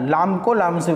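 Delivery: usual speaking voice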